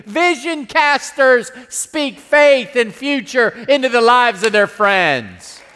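ordinary talk

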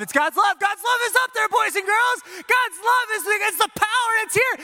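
put-on very high-pitched hype for children; frenzied excitement. Leader announcing God's love to boys and girls, high frenzy. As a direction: build the wonder into a high frenzy. Frenzied build-up performed for kids.

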